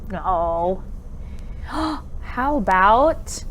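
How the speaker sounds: ordinary talk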